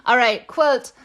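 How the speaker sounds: ordinary delivery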